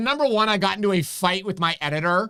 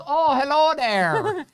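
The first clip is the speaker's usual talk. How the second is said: high, slow, sing-song "Oh, hello there"; imitating mother-in-law; 10 semitones higher, 3 dB louder, much slower than usual. Husband mocking his mother-in-law's greeting, high and slow. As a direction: stretch it out way up like a sweet old lady. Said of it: "No, that's not what she does. She goes".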